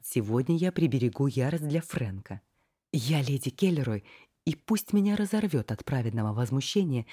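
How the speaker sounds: ordinary delivery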